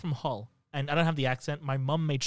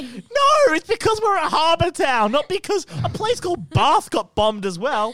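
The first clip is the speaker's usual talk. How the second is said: louder, higher, defensive; high defensive protest; 12 semitones higher, 8 dB louder than usual. Real, not acted. Defending his hometown, loud and high-pitched. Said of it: "he's defending himself".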